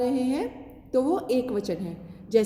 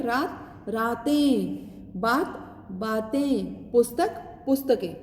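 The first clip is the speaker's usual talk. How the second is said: रात, रातें drawn out slowly; slow singular-plural pairs; much slower than usual. Shown as a demonstration. Teacher slowly models plurals to students. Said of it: "अ की ध्वनि आ रही है".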